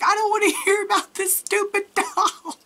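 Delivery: high-pitched mock fed-up retort; laughing exasperation. Gerald sick of hearing about the doll, mock-exasperated. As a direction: imitate an exasperated husband through laughter.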